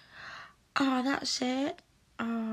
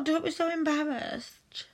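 usual speaking voice

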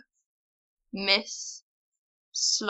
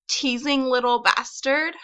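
put-on "bastard" lifted in a Valley girl rise; ditzy Valley girl upspeak on "Teasing little bastard"; 2 semitones higher than usual. Shown as a demonstration. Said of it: "apparently attractive Valley girl".

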